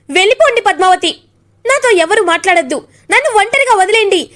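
rising, high shout to be left alone; grieving wife yelling at her sister-in-law to leave, rising high; loud shout. A narrator's reading. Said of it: "అని గట్టిగా అరుస్తుంది".

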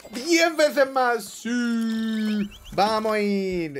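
high, loud drawn-out cheering; excited cheer. Real, not acted. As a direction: hype it up, stretching the words.

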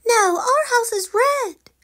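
high, slow correcting voice; little girl bear. Acted. Sally Forgetful correcting her brother, high and slow. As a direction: set him straight like a small girl.

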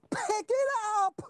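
very high, drawn-out mock scream. Drummer screaming at the kid looking at him. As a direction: stretch it into a high frantic holler. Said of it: "I'm just screaming".

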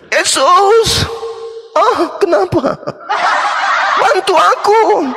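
high, slow, whiny plea for help; pleading Rasmi. Acted. Rasmi begging Jesus to save her, high whiny plea. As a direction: beg like a frightened woman at judgement.

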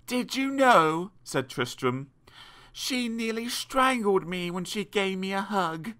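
a hint of a tattling little boy; tale-telling boy. A narrator's reading. Boy telling tales to parents.

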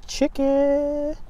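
chicken squeaked high and soft; squeaky chicken. Pretend. Showing the sandwich to viewers, squeaky high. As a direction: chirp the word chicken up high.

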